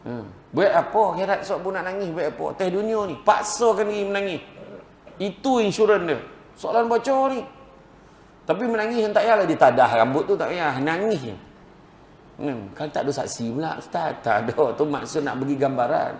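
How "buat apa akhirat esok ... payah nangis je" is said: high, loud, urgent sermon push; fiery preaching. Acted. Preacher urging the crowd to weep for God, fiery preaching. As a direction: drive it home to the congregation.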